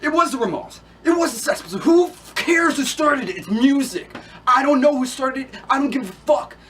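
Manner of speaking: high, loud exasperated shouting; angry rant. Acted. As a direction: shout it like you're sick of the whole argument. Heated rant over who started punk.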